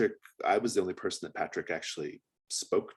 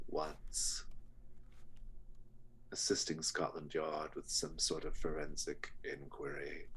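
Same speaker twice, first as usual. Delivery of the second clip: measured, quiet menace with long pauses; a courteous villain savoring each phrase; sinister butcher; 4 dB quieter than usual. Acted. Butcher telling Doctor Noble of his father's interest, sinister and measured.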